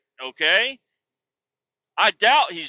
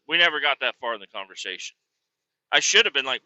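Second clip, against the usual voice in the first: ordinary delivery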